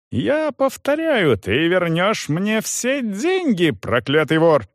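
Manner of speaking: high-pitched, slow, furious insistence; shrieked demand. A narrator's reading. Young man demands his money back, shrieking. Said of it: "взвизгнул юноша".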